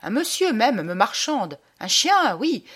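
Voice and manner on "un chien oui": ordinary delivery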